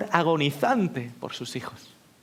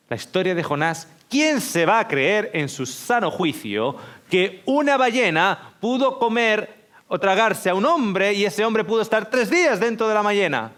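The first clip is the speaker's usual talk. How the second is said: scoffing teacher; 4 semitones higher, 5 dB louder than usual. Acted. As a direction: ridicule the boy's belief. Mocking teacher voiced. (profesor burlón y fuerte)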